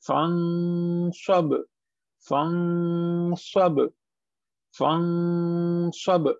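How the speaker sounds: फंसब with a long n hidden in the nose; nasal ikhfa. Shown as a demonstration. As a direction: stretch the nasal hum in फंसब before sab. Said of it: "इसे हम छुपा रहे हैं".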